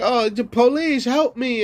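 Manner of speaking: high whiny plea for help; mock a scared citizen begging police; mocking a helpless caller. Imitating a caller begging the police, high whine. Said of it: "You just like".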